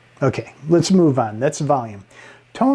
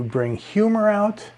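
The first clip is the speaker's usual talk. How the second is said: humorous lift; 7 semitones higher than usual. Shown as a demonstration. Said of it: "pitch can be used".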